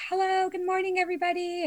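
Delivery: high, bright sing-song hello; cheery greeting. Retold or imitated. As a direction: chirp it like a happy morning greeting. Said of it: "normally you walk in and you're, like, happy".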